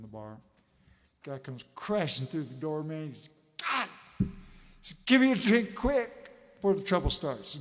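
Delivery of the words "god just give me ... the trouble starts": frantic bar customer. Acted. Panicked drunk rushing into bar.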